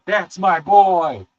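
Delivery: slow, drawn-out proud praise; proud parent. Retold or imitated. Savoring it slowly.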